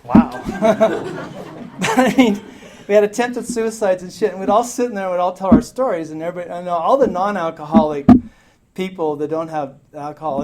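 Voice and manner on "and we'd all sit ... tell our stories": normal talking voice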